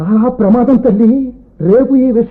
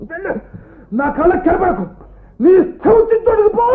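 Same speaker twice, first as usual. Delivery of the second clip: higher, bitter order to get out; angry dismissal; 7 semitones higher than usual. Acted. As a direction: banish her from your sight. Father throws daughter out. (కోపంగా వెళ్ళగొట్టడం)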